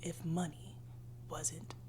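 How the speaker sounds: lying by candlelight, she whispers the question; whispered question. Acted. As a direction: whisper it as if to your inner self.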